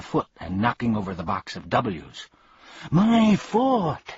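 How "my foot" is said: very high, loud yelp; pained shout. Acted. Bee hit on the foot by the cane, high yelp. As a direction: cry out as he's hit. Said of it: "shouted the bee".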